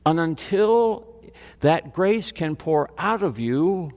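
normal talking voice

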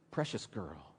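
very soft, low endearment; affectionate. Shown as a demonstration. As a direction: murmur it tenderly, like a loving father. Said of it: "it's an affectionate term".

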